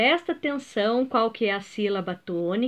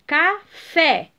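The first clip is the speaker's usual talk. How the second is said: "café" split in two, "fé" higher and stronger; stress on last syllable; 4 semitones higher, 4 dB louder than usual. Shown as a demonstration. Teacher showing pupils another word, stressing final "fé" in "café". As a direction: break "café" apart and punch "fé". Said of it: "é a última sílaba tônica".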